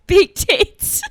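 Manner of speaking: high, very loud British punchline; British accent. Shown as a demonstration. Guest landing the joke, loud British accent. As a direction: blurt it out in mock British. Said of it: "Let's hear a British accent".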